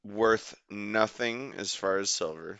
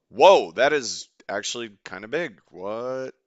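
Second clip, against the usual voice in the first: surprised; 9 dB louder than usual. Real, not acted. Sees the catch surface.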